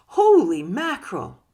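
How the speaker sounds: a loud, stunned holy mackerel; shocked exclamation. Retold or imitated. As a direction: burst out in disbelief. Reacting to something that must end.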